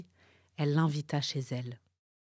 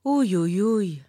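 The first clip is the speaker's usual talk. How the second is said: loud little cries of pain; ouch cries; 4 semitones higher, 6 dB louder, slower than usual. A narrator's reading. Beaten turtle cries ouch, louder.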